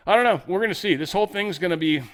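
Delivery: ordinary talk, nothing marked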